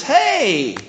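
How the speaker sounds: high, heartfelt hey; light up at seeing a long-missed friend; delighted greeting. Shown as a demonstration. Greeting someone after a long time, delighted. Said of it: "it's more like this".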